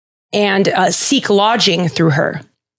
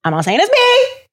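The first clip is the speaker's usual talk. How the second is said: mock-innocent disclaimer; 12 semitones higher than usual. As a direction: voice shooting up high at the end. Jokey disclaimer, then repeated plainly.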